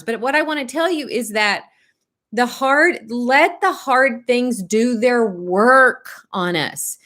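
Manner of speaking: ordinary delivery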